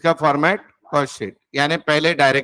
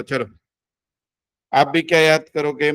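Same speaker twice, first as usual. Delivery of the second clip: ordinary talk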